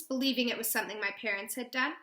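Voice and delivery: ordinary delivery, nothing marked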